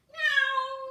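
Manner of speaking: high, loud mock meow; squeaky pretend kitty sound; playful cat meow. Acting the cat pose.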